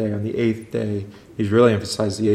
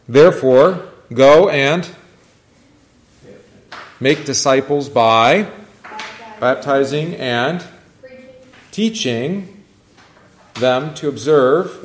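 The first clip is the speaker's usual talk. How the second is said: slow, louder preacher cadence; proclaiming scripture; 4 semitones higher, 6 dB louder, much slower than usual. Acted. Teacher quotes Matthew to class, slow louder proclamation. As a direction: declare the Great Commission to the room.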